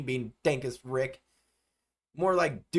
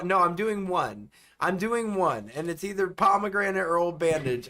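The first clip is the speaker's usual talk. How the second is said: ordinary talk, nothing marked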